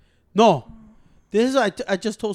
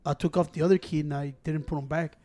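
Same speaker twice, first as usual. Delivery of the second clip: meek Ciro voice; 7 semitones lower, 8 dB quieter than usual. Retold or imitated. Imitating Ciro answering about keys.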